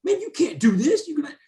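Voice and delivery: heated retold shouting; retold angry yelling. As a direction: yell threats at the new guy. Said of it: "screaming at me".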